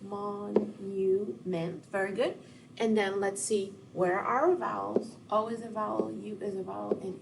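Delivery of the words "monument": Monument drawn out very slowly, each syllable apart; Mon... u... ment, slow and soft; split into syllables. Shown as a demonstration. Teacher splitting the word for students, slow. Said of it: "Let's split this word into syllables".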